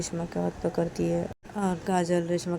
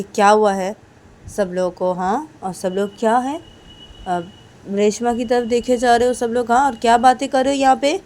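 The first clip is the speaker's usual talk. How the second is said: loud, higher challenging voice; confronting Kajal; 5 semitones higher, 12 dB louder than usual. Acted. Kajal challenging the teachers, loud and pushy. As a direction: press them all for an answer.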